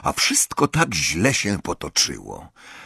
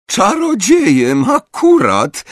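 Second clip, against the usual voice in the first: loud, high, drawn-out scoff; mocking childish exclamation, high and slow; derisive cry; 13 semitones higher, 6 dB louder, slower than usual. A narrator's reading. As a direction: jeer it out with the voice pitched way up. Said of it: "zawołała, odzyskując odwagę".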